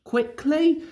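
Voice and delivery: ordinary delivery, nothing marked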